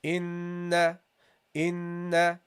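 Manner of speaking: "inna" with a long, slow nasal hum on the n; held nasal ghunna. Shown as a demonstration. Teacher models the nasal hum for students, slow. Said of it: "So you go here".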